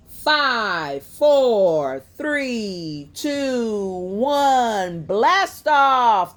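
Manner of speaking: loud, high rocket countdown building to blast off; excited yelled countdown. A narrator's reading. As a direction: count down like kids launching a rocket. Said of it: "the girls yelled".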